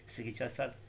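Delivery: ordinary delivery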